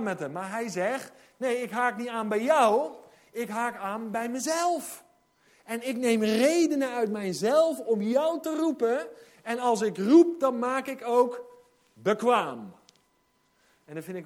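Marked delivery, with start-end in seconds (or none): 1.4-12.7 preaching God's voice. Acted. Preacher voices God calling Moses.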